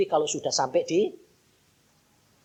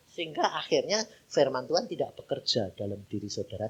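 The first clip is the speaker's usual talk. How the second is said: ordinary talk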